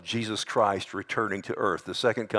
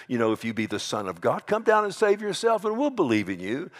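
mocking taunt; 5 semitones higher than usual. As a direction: jeer rising to a high pitch. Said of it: "Remember how they mocked Jesus".

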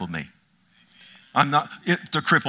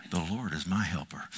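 "The Lord is my helper" said low and much softer, to himself; quiet private affirmation; 7 semitones lower, 10 dB quieter than usual. Shown as a demonstration. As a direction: murmur it alone in the office. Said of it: "I'll walk around my office and I'll say".

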